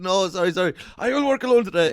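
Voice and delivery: high pretend-contrite voice; mock apology. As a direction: squeak out a fake apology. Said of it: "you'd be like".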